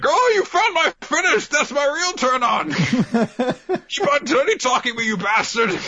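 put-on old man egging on the taunting, delighted; Kaseki turned on. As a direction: gush like a thrilled old pervert. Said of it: "And Kaseki's like".

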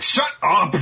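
high mock-furious outburst; hammed-up high-pitched shout; angry yell. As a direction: yell it as a hot-headed anime rival. Voices Bakugo snapping back.

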